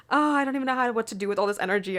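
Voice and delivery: usual speaking voice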